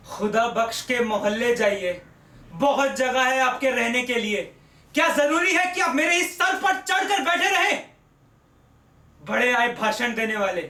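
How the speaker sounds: building from an irritated complaint to a furious shout; angry rant. Acted. Acted rant at unwanted tenant. (किरायेदार पर झुंझलाकर चिल्लाता मकान-मालिक)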